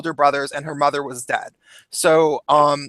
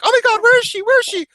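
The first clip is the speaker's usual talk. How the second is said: much higher, louder panicked calling; mock panic; 4 dB louder, much faster than usual. Girls searching the river for their friend, mock panic. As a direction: ham up frantic searching friends.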